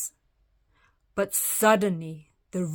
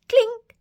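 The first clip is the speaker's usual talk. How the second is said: high clink; 7 dB louder than usual. Acted. Acorn sound.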